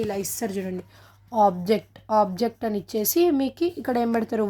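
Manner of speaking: normal talking voice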